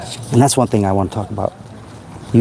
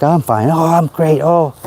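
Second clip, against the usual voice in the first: mock cheerful reply; 6 semitones higher than usual. Mocking a fake cheerful reply.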